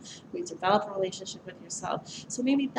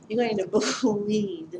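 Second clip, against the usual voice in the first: nervous laugh; 5 dB louder than usual. Real, not acted. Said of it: "I'm laughing because it's more of a nervous laugh".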